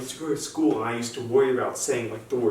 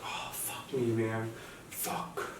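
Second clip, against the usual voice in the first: hushed curse to yourself; dismayed whisper; 9 dB quieter, much slower than usual. Retold or imitated. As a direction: whisper it under your breath, defeated. Muttered dismay after the slip.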